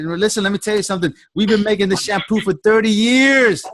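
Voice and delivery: salesy hype. Shown as a demonstration. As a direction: sell it like a showman. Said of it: "like that".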